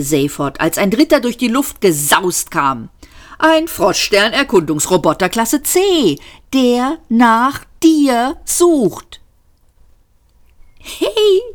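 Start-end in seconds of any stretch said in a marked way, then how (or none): 3.4-9.2 rising, very drawn-out announcement; emphatic warning. A narrator's reading. Man warning Seyfort of a third robot, emphatic. 10.8-11.4 high, amused exclamation; gleeful giggle. A narrator's reading. Seyfort tickled by the robots, giggling. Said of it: "kicherte Seyfort vergnügt in sich hinein".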